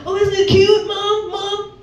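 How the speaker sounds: put-on high, bouncy kid voice, slow on so cool; excited little kid. A little boy begging to pet the squirrel, high and eager. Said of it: "he's like".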